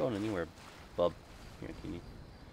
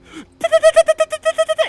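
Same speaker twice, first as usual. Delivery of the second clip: huge high stuttering gasp; loud mock gasp; 14 dB louder than usual. Imagining Larna gasping in shock, hammed-up gasp. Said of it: "and you're like".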